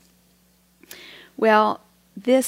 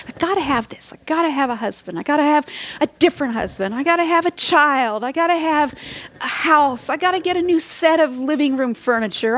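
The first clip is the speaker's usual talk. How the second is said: higher-pitched mock craving, piling up demands; insistent wanting; 5 semitones higher than usual. Preacher mimics people chasing what satisfies, insistent and wanting. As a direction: voice a restless list of must-haves. Voicing people's restless wants.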